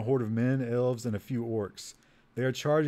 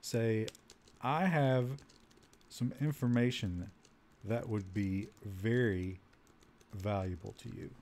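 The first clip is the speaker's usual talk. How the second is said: halting phrases, growing lower and softer; slow dictation; 3 semitones lower, 6 dB quieter, slower than usual. Real, not acted. Player typing an offer to the guard, slow dictation. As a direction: voice each word while typing it. Speaking slowly while typing input.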